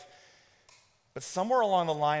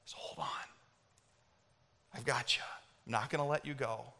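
very soft, low and close; tender reassurance; 4 semitones lower, 12 dB quieter than usual. Acted. God saying to you today, hushed tender reassurance. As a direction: promise it gently, almost under the breath.

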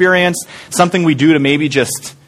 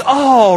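disappointed oh; 9 semitones higher than usual. Retold or imitated. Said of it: "her heart just goes".

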